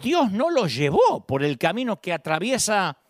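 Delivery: ordinary talk, nothing marked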